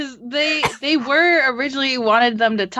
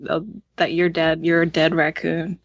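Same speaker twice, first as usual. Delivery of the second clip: mock deep voice; 6 semitones lower than usual. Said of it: "that was like".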